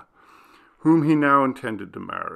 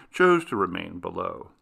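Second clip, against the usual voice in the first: usual speaking voice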